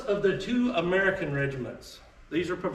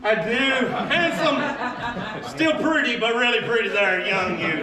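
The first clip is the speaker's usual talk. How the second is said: higher, laughing brag; laughing self-tease; 4 semitones higher, 4 dB louder, slower than usual. Real, not acted. Presenter laughing at his own young photo, louder and higher. Teasing himself over old photo.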